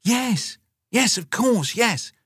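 quick, high, flustered yeses; hurried. Acted. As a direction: rush to agree, caught out. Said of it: "said Mum hurriedly".

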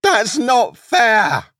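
high, loud, drawn-out wail; howled protest. A narrator's reading. Wolf protesting the lamb's kiss, howling. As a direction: stretch each word into a howl. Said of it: "howled the wolf".

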